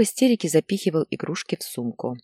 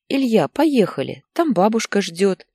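mother coaxing her son, higher; pleading; 5 semitones higher, 4 dB louder than usual. A narrator's reading. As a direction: plead with a child to come along. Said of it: "просила она сына". (мать упрашивает сына)

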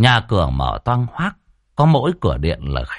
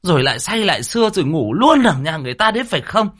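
much higher, nagging furious wife's tirade; scolding wife; 10 semitones higher than usual. A narrator's reading. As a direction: scold the drunk husband nonstop. Wife drags drunk husband home. (vợ chì chiết mắng chồng, giọng the thé)

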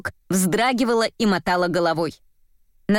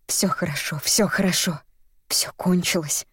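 soothing; 2 semitones lower than usual. A narrator's reading. The artist holds the trembling actress, soothing. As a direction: hush and comfort her gently. Man hugs and comforts her.

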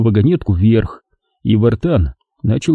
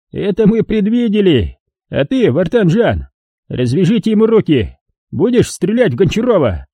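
put-on high, gloating old man voice; a cruel old miner mocking his victim; old prospector; 8 semitones higher than usual. A narrator's reading.

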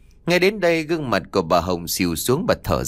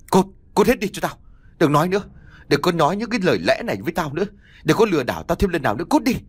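much higher, fast furious shouting; furious yelling; 6 semitones higher, faster than usual. A narrator's reading. Mother-in-law throwing son and wife out, furious yelling. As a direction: scream them out of the house.

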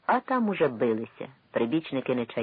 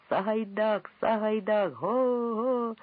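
1 crazed crowd roar; 9 semitones higher, much slower than usual. A narrator's reading. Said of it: "заревів, ніби ошалівши увесь майдан".

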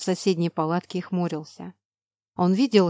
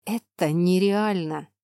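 slow hiss through clenched teeth; slower than usual. Acted. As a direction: hiss it, jaw locked. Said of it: "прошипел он сквозь зубы". (шипение сквозь зубы)

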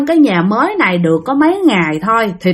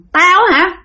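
put-on higher, contemptuous old man; old spirit telling the family to leave, higher voice; gruff old ghost; 6 semitones higher than usual. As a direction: order them off his land. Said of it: "Cái ông cụ nói rằng".

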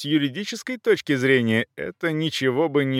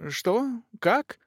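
high, puzzled questions; confused little high-pitched queries; bewildered; 12 semitones higher than usual. A narrator's reading. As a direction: ask it high, lost and taken aback. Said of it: "не понимала суть разговора".

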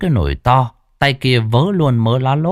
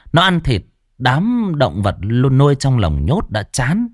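ordinary delivery, nothing marked

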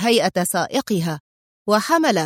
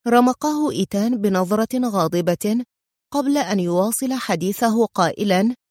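ordinary delivery

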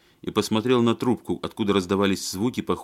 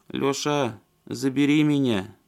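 plaintive; 6 semitones higher, slower than usual. Acted. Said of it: "жалобно сказала".

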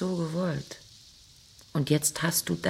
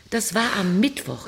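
louder, cutting; raised taunting voice; 5 semitones higher, 6 dB louder than usual. Acted. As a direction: throw it at him. Wife taunts husband. (lauter, schneidender Vorwurf)

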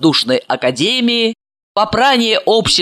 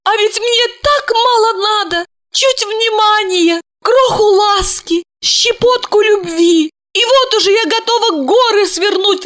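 high tipsy tearful woman; drunk crying; 13 semitones higher than usual. A narrator's reading. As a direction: lament it drunk and teary. Asya's drunk tearful lament continues.

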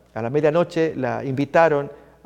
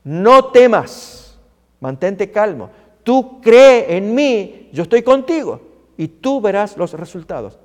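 loud, slow, stretched key words; Jesus commanding faith; 3 semitones higher, 7 dB louder, slower than usual. Acted. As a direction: proclaim it with weighty emphasis. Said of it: "Oyendo Jesús le, le dice".

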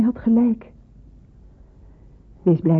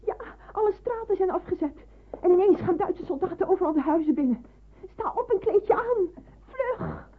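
much higher, breathless alarm; alarmed urgency; 12 semitones higher than usual. Acted. Landlady wakes Hansje to warn of the raid, panicked and high. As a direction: rush him out of bed in panic.

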